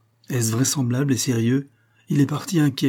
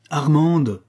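raised, louder exclamation of her name; protesting cry; 5 semitones higher, 5 dB louder than usual. A narrator's reading. Martel interrupting Armande's painful confession, protesting cry. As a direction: cut in with a raised, pleading call.

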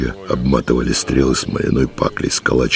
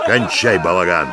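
mildly raised, curt command; barked order; 4 semitones higher than usual. A narrator's reading. Commander stops the couple's quarrel, understated bark. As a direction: cut in with a touch of a bark. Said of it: "рявкнул Нелидов".

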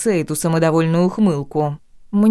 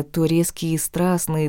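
ordinary delivery

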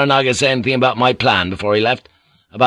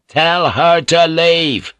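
louder, slow, every word hammered; ringing command; 6 semitones higher, 4 dB louder, much slower than usual. Acted. Blackthorn repeating his demand to Mariko, a slow booming order. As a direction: let it ring round the house. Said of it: "Blackthorn's words ricocheted around the veranda".

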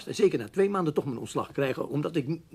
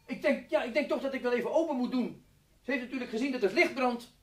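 raised calling voice; 9 semitones higher than usual. Acted. Roept naar boven.

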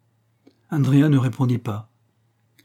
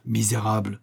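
usual speaking voice

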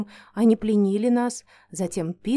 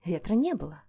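usual speaking voice

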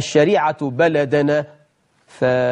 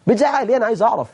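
raised challenge; 7 semitones higher, much faster than usual. Shown as a demonstration. Challenging a shouting guest, pitched high and pressing. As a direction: throw بتزعق ليه back high and pressing. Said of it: "هي خناقة".

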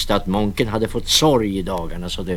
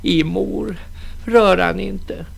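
pleading daughter; 10 semitones higher, slower than usual. Acted. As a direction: beg her mother to stop. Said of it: "bad dottern".

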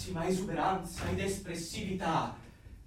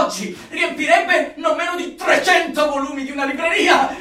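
higher, loud, near-shouting rapture; ecstatic zeal; 5 semitones higher, 8 dB louder than usual. Acted. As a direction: preach it like fireworks going off. Zealot rhapsodising about word fireworks.

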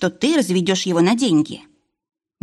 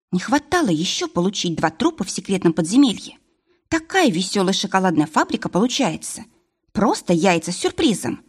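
plain reading voice

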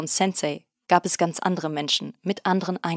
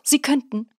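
climbing indignant insistence; 7 semitones higher than usual. A narrator's reading. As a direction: repeat 'könnten' even higher, pleading and outraged. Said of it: "seine Empörung auszudrücken".

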